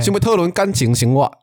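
Taiwanese accent. Shown as a demonstration. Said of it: "那我们再一次".

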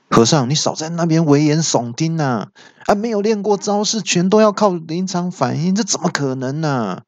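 high-pitched, incredulous scolding; villain big brother. A narrator's reading. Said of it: "这时候大哥妖魔鬼怪突然说话". (大哥高声质疑)

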